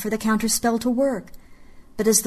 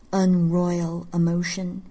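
ordinary delivery

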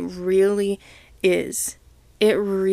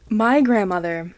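ordinary talk

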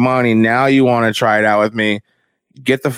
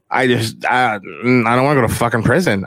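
ordinary talk, nothing marked

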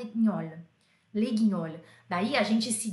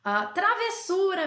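delighted; 8 semitones higher, 4 dB louder than usual. Real, not acted. Delighted at a viewer's suggestion.

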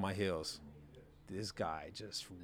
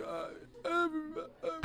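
high, strained, breathless stammer into a broken laugh; 4 dB quieter, much slower than usual. Retold or imitated. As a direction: gasp out the words like you can't breathe. Gasping for breath, retold hit.

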